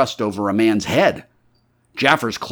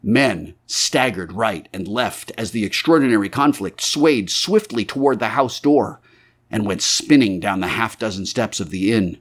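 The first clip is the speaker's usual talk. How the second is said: usual speaking voice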